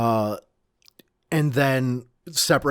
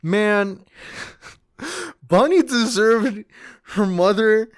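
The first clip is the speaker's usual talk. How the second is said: moved, high-pitched and a little choked; real welling-up, pitch raised; a touch tearful; 8 semitones higher, 3 dB louder than usual. Real, not acted. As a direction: gush as if close to tears. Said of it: "kind of actually tearing up".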